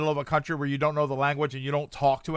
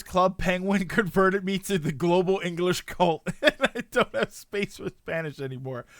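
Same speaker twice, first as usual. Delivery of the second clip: laughing through the words. Real, not acted. Streamer amused by a viewer's language story, laughing. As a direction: read it out while breaking into laughter. Amused reading out a chat message.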